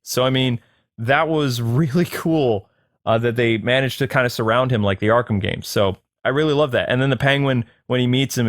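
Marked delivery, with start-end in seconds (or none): none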